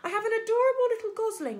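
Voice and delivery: high, loud, cheerful announcement; friendly booming. A narrator's reading. Robot introduces the gosling to the distant goose, booming and friendly. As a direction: call it out sweetly over water. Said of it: "the robot's friendly voice boomed".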